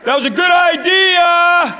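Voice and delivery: slow, drawn-out sideline bellow; yelling dad. Retold or imitated. Dad yelling encouragement at his son, slow bellow. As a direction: shout the praise across the field. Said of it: "starts yelling at his son".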